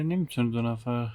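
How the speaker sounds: ordinary talk, nothing marked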